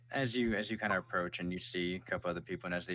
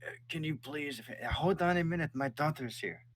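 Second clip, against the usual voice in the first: higher, flustered soldier; soldier's voice; 8 semitones higher than usual. Acted. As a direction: hurry to make room for the daughter. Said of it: "he's like".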